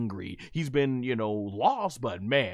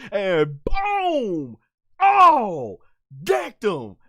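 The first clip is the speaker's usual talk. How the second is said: much higher, louder hype yell; excited shout; 4 semitones higher, 9 dB louder, much slower than usual. Real, not acted. Fan reacting to a big punch, excited shout. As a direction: yell it like a fan watching a knockout.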